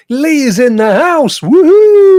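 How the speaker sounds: high loud woohoo; cheer. Real, not acted. Host whooping as a viewer joins.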